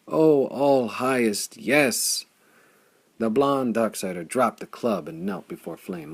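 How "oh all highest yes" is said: slow, higher, louder exclamation; a kneeling warrior hails his savior; hoarse cry of awe. A narrator's reading. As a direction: cry out in reverent joy. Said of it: "he cried hoarsely".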